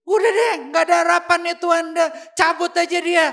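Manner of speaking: very loud, rapid fed-up shout; acted exasperated outburst. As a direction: throw up your hands, shouting at God. Acted outburst at God, giving up. (seruan putus asa yang keras)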